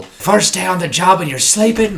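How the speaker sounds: officer scolding the sleepy new boy, mock stern.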